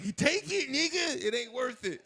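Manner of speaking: high, loud pleading yelp; retold yelp. Scared eleven year old giving up the candy, high yelp. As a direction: squeal it out in a panic. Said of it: "I'm like".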